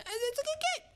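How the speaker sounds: very high, thin mock voice on "good game"; squeaky falsetto. Streamer repeating that it's a good game in a squeaky voice.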